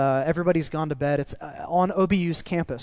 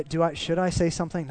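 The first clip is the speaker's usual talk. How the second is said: normal talking voice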